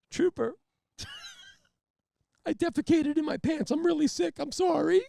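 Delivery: high, loud tearful wail; tearful confession. Retold or imitated.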